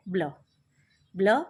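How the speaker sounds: ब्ल said as one blended sound, b and l run together. Shown as a demonstration. Teacher shows pupils how to blend ब्ल together. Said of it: "इनको साथ में बोलना है".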